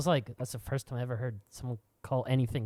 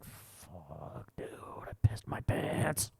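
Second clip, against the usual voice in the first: hushed, mortified whisper; whispered curse; 9 semitones lower, 7 dB quieter, much slower than usual. Retold or imitated. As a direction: mutter it under the breath in dismay. Inner thought whispered.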